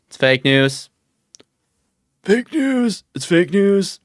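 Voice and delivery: high-pitched attempt at a presidential impression; badly faked politician crying fake news; Trump. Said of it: "I can't do a Trump impression".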